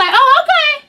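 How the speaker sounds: mock high surprised okay. Friend mimicking the mom's reaction, high mock okay. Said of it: "This heifer gonna say".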